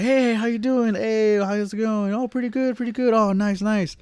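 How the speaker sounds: high, falsetto fake-cheerful hellos; nervous greeting. Nervous boyfriend greeting the girlfriend's dad in a high squeaky voice. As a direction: squeak out awkward small talk to the dad.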